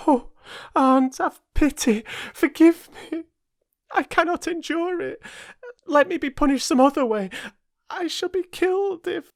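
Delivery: put-on high, frantic child begging; desperate pleading. Young Jane begs her aunt not to lock her in, high and frantic. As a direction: beg for mercy in terror.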